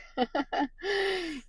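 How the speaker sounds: squeaky amused laugh; high giggle. Real, not acted. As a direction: giggle at how you sound. Laughing at own tired voice.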